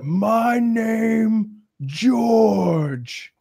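high, strained ape voice; a proud ape naming itself. Retold or imitated. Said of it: "he's like".

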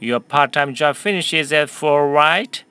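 slow, clear sentence for repeating; teacher modelling a question slowly for students; slow model reading. Shown as a demonstration. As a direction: read it slowly for the class to echo. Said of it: "한 번 더 할까요?".

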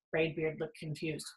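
ordinary delivery, nothing marked

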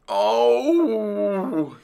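high, drawn-out "oh"; gasped. A narrator's reading. Dentist inside the fox's smelly mouth, gasping. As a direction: gasp it long and high. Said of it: "he gasped".

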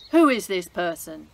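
a loud, outraged royal shout; king's shout. Acted. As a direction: bellow the demand at the court. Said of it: "shouted the king".

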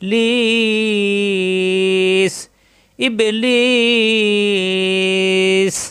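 long, drawn-out chanted recitation; recited slowly. Shown as a demonstration. Teacher demonstrating the recitation, slow chant. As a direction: stretch it out in tajweed chant. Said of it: "Quran recitation demo".

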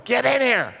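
high, loud yell from a woman in labor. Retold or imitated. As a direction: holler it urgently from inside. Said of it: "and she yells".

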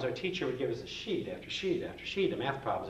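normal talking voice

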